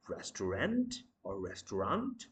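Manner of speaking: restaurant in three full syllables, "res-to-rant", "res-to-ront"; mispronounced restaurant. Shown as a demonstration. Teacher quizzing learners on wrong forms, restaurant in three syllables. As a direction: sound every syllable of restaurant.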